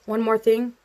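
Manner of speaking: usual speaking voice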